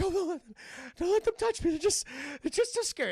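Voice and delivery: high, mock-terrified whimper; scared to death. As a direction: squeak it like a frightened player. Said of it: "he's scared to death".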